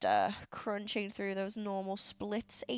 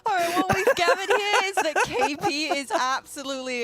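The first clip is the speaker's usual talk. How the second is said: mock announcement; 9 semitones higher, 8 dB louder than usual. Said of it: "exceptional at, uh, voices".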